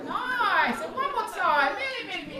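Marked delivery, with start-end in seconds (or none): none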